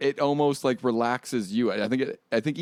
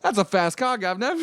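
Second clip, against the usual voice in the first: put-on British accent; 8 semitones higher, 3 dB louder than usual. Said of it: "that British in there".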